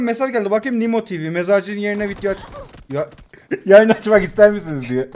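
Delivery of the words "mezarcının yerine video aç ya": usual speaking voice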